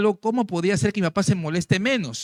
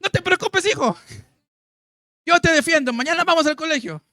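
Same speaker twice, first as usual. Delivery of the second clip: much higher and louder, a delighted father reassuring his son; glad father; 9 semitones higher, 4 dB louder than usual. Retold or imitated. Father cheerfully promising his son to defend him, high delighted voice. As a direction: play the happy dad promising to defend him. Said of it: "se alegró".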